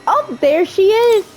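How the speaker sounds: high, delighted squeal; pleased, high-pitched discovery; excited spotting. Real, not acted. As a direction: jump up in pitch on finding her. Spots the capybara.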